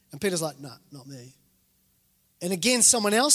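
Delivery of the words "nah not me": very soft, low brush-off; hushed, low acted denial; evasive denial. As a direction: deny it furtively, barely voiced. Peter denies knowing Jesus, acted.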